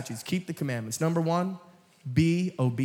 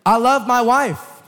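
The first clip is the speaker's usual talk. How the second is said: loud heartfelt declaration; 7 semitones higher, 9 dB louder, slower than usual. Acted. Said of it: "sermon illustration".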